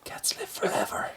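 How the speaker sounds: mock-secretive slow whisper; whispered retort. Parent answering kids who want a rabbit, slow whisper. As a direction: whisper it slowly, drawing it out. Exasperated reply to kids in retold exchange.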